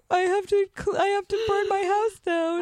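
panicked. Retold or imitated. Waking from nightmare panic.

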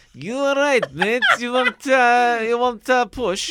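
hammed-up friendly Aussie offering help; Australian accent. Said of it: "these Australians got out".

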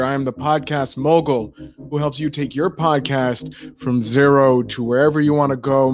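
usual speaking voice

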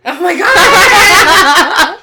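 loud, slow, high laughing shout. Real, not acted. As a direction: burst out laughing in shock. Shocked laughing reaction.

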